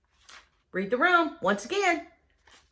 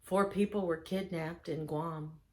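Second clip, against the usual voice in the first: lower, softer, awkward gloom; gloomy oversharer; 7 semitones lower, 7 dB quieter, slower than usual. Retold or imitated. Guest bringing up grim news at a happy dinner, low and gloomy. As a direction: drop the gloom on the party. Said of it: "and you're like".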